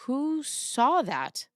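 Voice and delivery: slow, drawn-out demon voice; demonic layered voice. Acted. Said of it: "If it weren't for the demon voice".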